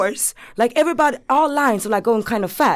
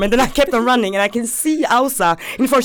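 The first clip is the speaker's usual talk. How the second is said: ordinary delivery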